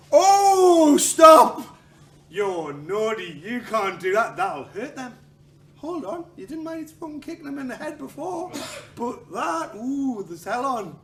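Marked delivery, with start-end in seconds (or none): 0.1-7.6 much higher and louder mock shriek, then slow scolding; hammed-up prissy outrage at a foul; outraged shriek. Mock spectator scolding a fighter, shrieking outrage. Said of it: "somebody goes".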